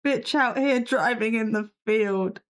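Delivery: normal talking voice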